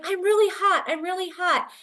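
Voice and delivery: high-pitched, insistent complaint; a boy urgently complaining, acted out; imitated distressed child. Overheated son in the car to mother, distressed imitation.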